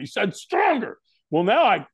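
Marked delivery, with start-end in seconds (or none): 0.4-1.0 very high-pitched yell at moderate volume; mock scream. Trainer screaming at firewalker right up close, mock yell. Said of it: "he screamed at me".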